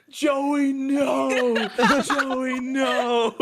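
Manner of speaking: high, loud drawn-out yell; hammed-up wailing shout; mock screaming. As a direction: re-enact screaming in horror at a friend. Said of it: "I was already screaming".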